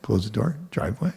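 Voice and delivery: normal talking voice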